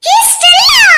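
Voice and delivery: high, loud cry of happy surprise; delighted surprise. Acted. Girl greeting her friend Stella with a loud, delighted shriek. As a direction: shout her name, thrilled to see her.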